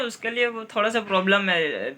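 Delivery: ordinary talk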